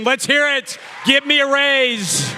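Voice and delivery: high, loud mock demand; playful yell for a raise; joking shout. Real, not acted. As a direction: shout it over the crowd, half joking. Joking demand to cheering crowd.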